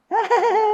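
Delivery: put-on high giggly toddler laugh; child's giggle. Toddler giggling while holding a cutter, high-pitched.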